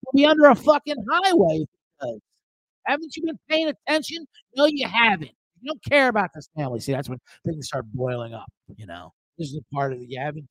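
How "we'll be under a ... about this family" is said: higher, indignant, pushing back hard; angry nephew at the dinner table; heated family retort. Acted. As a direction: stand up to the uncle. Arguing with uncle Jerry.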